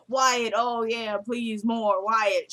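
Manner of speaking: slow, drawn-out fake moans; mock moaning. Wife faking noises for the listening mother, mock moaning. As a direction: moan it slowly for an eavesdropper, clearly faked. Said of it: "moaning Jeffrey's name, but nothing's really happening".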